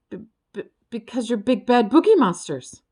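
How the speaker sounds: sputtering. A narrator's reading. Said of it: "Ferd sputtered".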